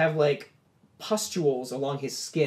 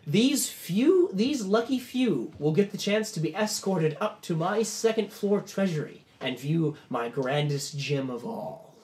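grand host; 4 semitones higher, slower than usual. Acted. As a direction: savor the prize for the crowd. Host announces the prize.

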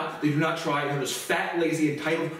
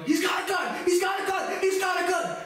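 much higher shouted alarm; onlookers yelling. Retold or imitated. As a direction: cry out a panicked warning. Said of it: "some onlookers start yelling".

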